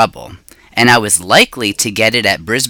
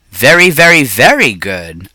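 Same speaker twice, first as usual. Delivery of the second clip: hamster squeak; 4 dB louder than usual. A narrator's reading. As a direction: squeak it out, pleased. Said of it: "I squeaked".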